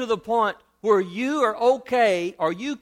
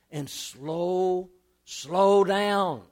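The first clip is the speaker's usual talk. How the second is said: drawn-out slow down; much slower than usual. Shown as a demonstration. Preacher demonstrates slowing down.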